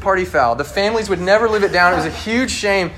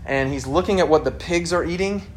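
usual speaking voice

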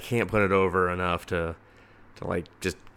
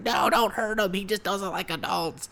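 high mock child, pleading; a lightly voiced boy begging, high pitched; a boy pleading for his spider, high voice; high pleading kid voice; 11 semitones higher than usual. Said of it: "He's like".

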